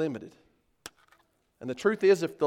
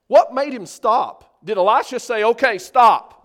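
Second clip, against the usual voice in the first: preaching shout; 10 dB louder than usual. Real, not acted.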